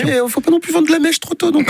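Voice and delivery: laughing through the words; high laughing voice, half joking. Real, not acted. (en riant)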